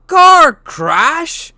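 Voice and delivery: loud furious roar. A narrator's reading. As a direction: roar it in outrage. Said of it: "roared Hagrid".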